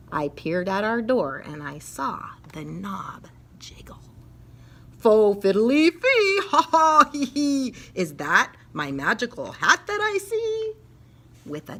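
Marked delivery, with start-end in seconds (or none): 1.4-4.0 hushed breathy whisper; suspense whisper. Acted. Narrator whispering as the door jiggles. 5.0-10.7 louder, cackling elf voice; giggling leprechaun. Acted. Leprechaun spotting his lost hat, giggling.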